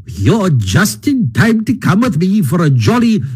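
high, jolly, swooping Toad voice; hearty invitation. A narrator's reading. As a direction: invite them along in high, hearty good cheer. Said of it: "His hearty accents faltered and fell away".

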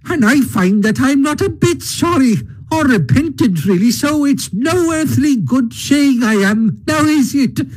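high, cheeky Toad voice; boastful Toad brushing off the Badger; Toad, unrepentant. A narrator's reading. Toad answers Badger defiantly.

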